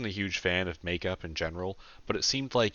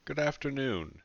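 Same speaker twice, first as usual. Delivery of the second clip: tense, higher-pitched greeting; 8 semitones higher than usual. A narrator's reading. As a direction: greet them with tension in a raised voice. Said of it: "with a tense voice".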